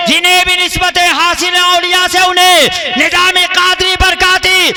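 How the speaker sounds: loud, high, slow declaimed verse; poetry recitation. Acted. Preacher reciting verse to the gathering, loudly declaimed. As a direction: proclaim each couplet to the crowd, drawn out.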